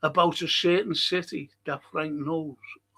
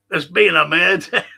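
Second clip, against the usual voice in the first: Scottish detective accent, louder, hammed-up; Taggart Scottish accent; 8 dB louder than usual. Quoting the Scottish TV detective Taggart, louder, in a Scottish accent.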